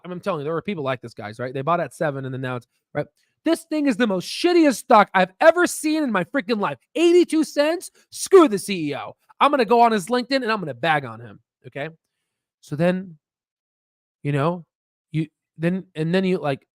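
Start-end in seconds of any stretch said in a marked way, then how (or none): 3.4-11.4 mock furious rant. Acted rant at stock.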